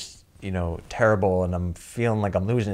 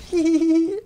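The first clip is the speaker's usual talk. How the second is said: giggling; 6 dB louder than usual. Retold or imitated. Woman giggles describing herself.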